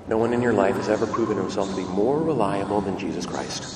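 normal talking voice

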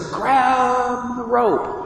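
high, very slow commanding call; rescuer's call. Retold or imitated. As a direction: call out slowly to the drowning man. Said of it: "You hear this voice says".

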